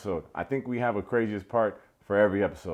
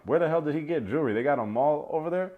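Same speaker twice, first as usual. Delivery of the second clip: higher and fast, baffled disbelief; incredulous; 5 semitones higher, faster than usual. Retold or imitated. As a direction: fire off the question in shock. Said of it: "And I'm like".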